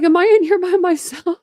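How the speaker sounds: laughing imitation of a chatter alone in the room; mock lonely chatter. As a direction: squeak it out through laughter like a lost little guest. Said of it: "She's like".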